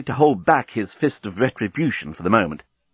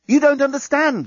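high-pitched, urgent call; calling after her; 3 dB louder than usual. A narrator's reading. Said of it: "the journalist called after her".

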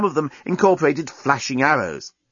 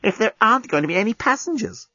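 higher, lightly voiced rhetorical question; reasoning schemer; 7 semitones higher than usual. A narrator's reading. Scraliontis justifying having no lifeboats, reasoning. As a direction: reason it out to the end. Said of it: "reasoned Scraliontis".

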